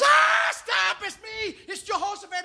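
very high, frantic mock pleading; panicked crying out. Jehoshaphat pleading with assassins aiming at him, crying out in panic. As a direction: yelp it out, begging for your life. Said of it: "and he's crying".

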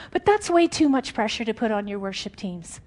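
ordinary delivery

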